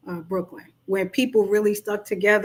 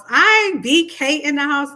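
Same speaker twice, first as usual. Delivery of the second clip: hype shout; 5 semitones higher, 6 dB louder than usual. Real, not acted. Hyping up Brooklyn.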